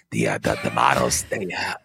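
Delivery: low, detached, boring official voice; Fauci impression. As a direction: drone it out with no emotion, stumbling over every word. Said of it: "does with no emotion".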